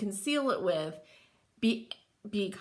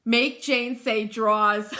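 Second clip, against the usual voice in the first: ordinary talk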